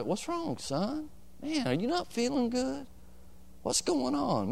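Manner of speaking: high, soft worried mother; concerned mother. Retold or imitated. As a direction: ask gently like a worried mom. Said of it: "my mom those three days was always".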